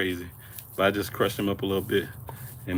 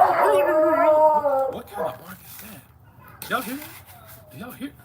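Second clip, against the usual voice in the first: incredulous; 6 dB louder, slower than usual. Real, not acted. Reacts to dog's terrible bark.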